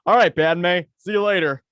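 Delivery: a hint of an animated character, breezy; normal-talking Jar Jar. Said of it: "you sounded a lot like an American animated character".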